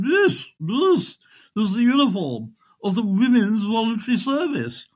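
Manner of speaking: high, slow and lofty lady's voice; a lightly voiced prim lady explaining; prim posh lady. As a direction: look down your nose as a grand lady. Posh lady answering the airman.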